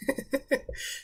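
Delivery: short, soft chuckle; amused chuckle. Real, not acted. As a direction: chuckle quietly to yourself. Chuckles at the joke.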